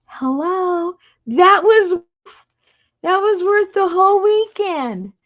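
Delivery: high, loud delighted exclaiming; thrilled. Real, not acted. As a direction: gush about the sewing trick. Thrilled by the needle trick.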